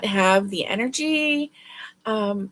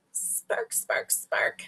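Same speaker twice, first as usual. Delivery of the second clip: hushed, whispered sparkle of words; soft voiceless whisper; playful whisper; 7 dB quieter, much slower than usual. Real, not acted. Playfully echoes a viewer's intended word.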